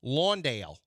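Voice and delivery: Lawndale with a long open aw; Australian Lawndale. Shown as a demonstration. Host showing the Australian way, Lawndale with aw. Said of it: "that's how you pronounce it".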